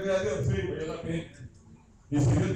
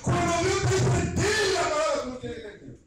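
fervent preaching; 9 semitones higher, 4 dB louder than usual. Acted. Preacher repeating God's command.

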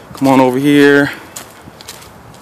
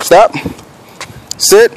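firm command; 7 semitones higher than usual. Real, not acted. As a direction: bark the commands up high. Commanding dog to stop, sit.

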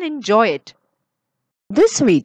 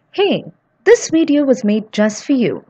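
plain reading voice

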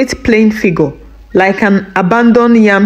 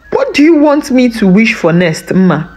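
ordinary delivery, nothing marked

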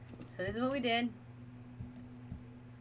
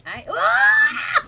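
sudden shrieking yelp, much higher and louder; startled scream; 15 dB louder than usual. Real, not acted. Streamer jolted by a monster, screaming in fright. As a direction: scream as something leaps out. Jump scare in horror game.

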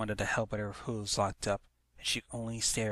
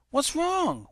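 alarmed pony; 5 dB louder than usual. Acted. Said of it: "one of them inquired".

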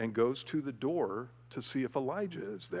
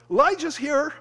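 much higher, much louder excited yell; thrilled kid shout; 13 dB louder than usual. Retold or imitated. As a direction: shout the big news like a thrilled child. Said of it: "the kid's gonna turn around to his parents and go".

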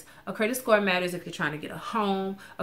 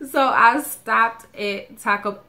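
slow, louder mock Spanish accent on Taco; 4 semitones higher, 8 dB louder, slower than usual. Telling viewers about her Taco Bell stop, in a playful mock Spanish accent. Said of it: "I have to say it with the accent".

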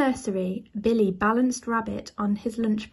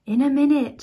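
put-on higher, louder child's reply; mild shout; 4 semitones higher, 6 dB louder, faster than usual. A narrator's reading. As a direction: shout back to mum, lightly acted. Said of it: "shouted Billy".

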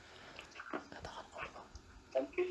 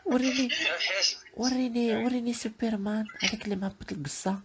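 put-on high girlish begging; mock pleading girl; 7 semitones higher than usual. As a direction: beg high and whiny like a little girl.

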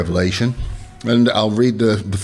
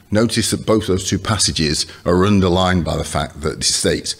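ordinary delivery

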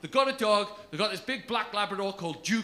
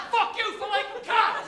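mock dog-lover heckle; 12 semitones higher than usual. Voicing angry dog people.

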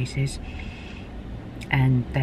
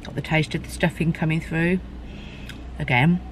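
talking with food in mouth; chewing between words; mouth full, chewing; 4 dB quieter than usual. Real, not acted. Seen 2: Took bite before; lips pressed, hand at mouth, chewing between words.

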